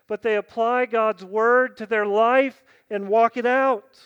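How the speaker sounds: loud, slow, emphatic preaching; full-force pulpit exhortation. Acted. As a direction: hammer each phrase home.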